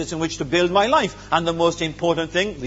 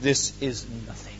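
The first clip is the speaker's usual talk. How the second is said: quiet reverence; 9 semitones lower, 6 dB quieter than usual. Acted. Paul's quiet verdict.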